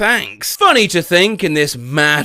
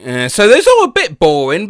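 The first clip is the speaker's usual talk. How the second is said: ordinary delivery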